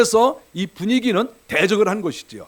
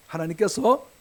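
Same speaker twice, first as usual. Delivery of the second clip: ordinary delivery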